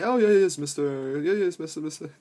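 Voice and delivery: lightly voiced eager yes man; a hint of fawning agreement. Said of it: "fucking yes man that say".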